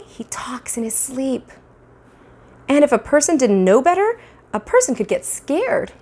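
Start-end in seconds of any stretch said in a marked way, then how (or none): 0.0-1.5 hushed, unvoiced whisper, slowed down. Narrator whispering to listeners about sleeping brother, hushed. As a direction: breathe it like a secret in the dark.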